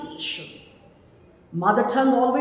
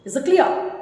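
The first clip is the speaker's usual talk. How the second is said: ordinary talk, nothing marked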